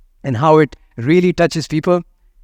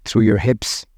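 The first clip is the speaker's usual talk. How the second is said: guided meditation; 3 semitones lower than usual. Acted. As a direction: let each phrase settle before the next. Guides a body scan.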